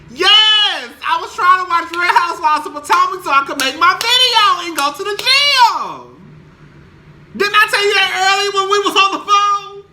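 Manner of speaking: high, loud, fed-up reply; exasperated yell. Retold or imitated. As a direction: yell it back at the friend who keeps calling. Exasperated reply to calling friend.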